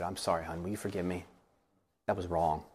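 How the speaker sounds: low, soft, subdued apology; apologetic. Retold or imitated. Husband apologizing to his wife after his outburst, low and contrite. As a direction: apologize quietly and humbly. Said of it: "I just apologized to her".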